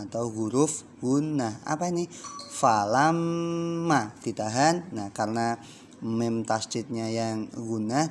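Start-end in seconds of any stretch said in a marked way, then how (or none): 2.6-4.9 Falamma dengan mm ditahan lama; held nasal mm. Shown as a demonstration. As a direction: tahan bunyi mm di Falamma dua ketukan. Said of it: "ditahan dua ketukan". (dengung mm ditahan dua ketukan)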